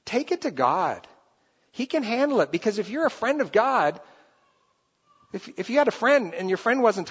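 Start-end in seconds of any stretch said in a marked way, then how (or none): none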